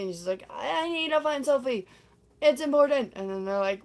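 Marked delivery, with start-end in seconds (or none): 0.5-3.1 higher, insistent retold plea; playfully urgent; mock urgency. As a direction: press the point, pitched up. Donna urgently seeking Sophie, retold.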